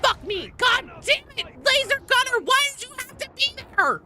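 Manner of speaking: loud, very high frustrated shout; high-pitched outraged yell; exasperated yelling. Real, not acted. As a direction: curse the enemy at full pitch. Cursing a gunner who hit them.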